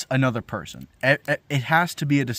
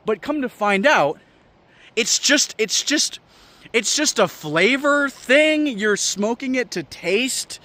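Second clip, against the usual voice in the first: incredulous rant; 9 semitones higher than usual. Real, not acted. Ranting at cigar smokers.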